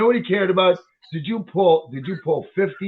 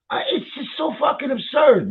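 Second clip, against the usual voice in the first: ordinary talk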